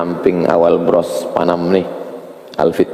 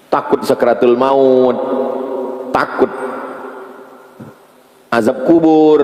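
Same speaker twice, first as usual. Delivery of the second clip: fervent preaching; 8 semitones higher than usual. Acted. Preacher listing fears of death to the crowd, full-force preaching. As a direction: drive each fear home.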